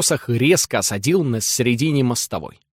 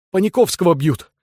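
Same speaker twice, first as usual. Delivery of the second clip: raised alarmed shout; 6 semitones higher, 3 dB louder than usual. A narrator's reading. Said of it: "закричал Балаганов".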